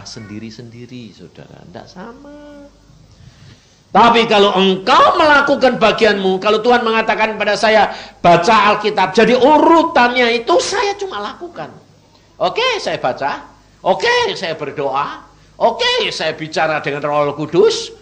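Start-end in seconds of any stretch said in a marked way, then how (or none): 3.9-11.7 louder, higher, driving preacher's call; fiery preaching. Acted. Preacher urging the congregation to obey. 12.4-17.9 loud, higher, slow and deliberate; eager obedience. Acted. Preacher acting out his obedient replies to God.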